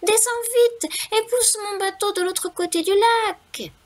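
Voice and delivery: high, eager mouse voice; little mouse voice. A narrator's reading. Mouse begging the wind for help, high and squeaky. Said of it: "s'écria le souriceau".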